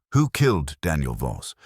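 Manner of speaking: usual speaking voice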